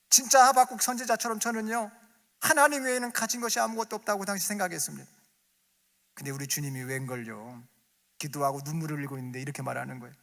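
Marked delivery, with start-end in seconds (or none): 0.1-5.1 fervent preaching. Acted.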